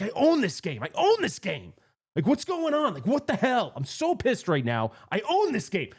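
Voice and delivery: high-pitched, indignant outburst; lunatic ranting. Retold or imitated. Player re-enacting his meltdown beside a kid, lunatic rant. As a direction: rant like a man losing it, hammed up. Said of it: "But I seem like a lunatic".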